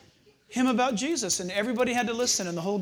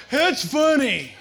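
tough biker voice; 6 semitones higher, 9 dB louder, much slower than usual. Retold or imitated. Gang leader voiced.

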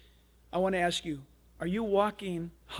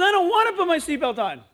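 put-on loud, high childish refusal; high-pitched mock tantrum protest; protesting little boy; 11 semitones higher, 9 dB louder than usual. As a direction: mimic a small boy digging in against his mom. Boy refuses in retold quarrel.

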